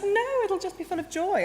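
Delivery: high-pitched, breezy retold denial; breezy dismissal. Retelling her breezy interview answer.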